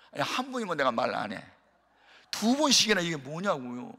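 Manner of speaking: usual speaking voice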